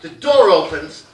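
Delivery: ordinary talk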